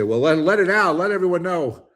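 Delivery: a naive person urging everyone to reveal bankruptcy, mock eager; mock naive suggestion. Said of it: "you say".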